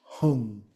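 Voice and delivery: "hung" with ng as a single nasal ending; N G as one. Shown as a demonstration. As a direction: let "hung" close softly on one ng sound. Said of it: "pronounced as a singularity".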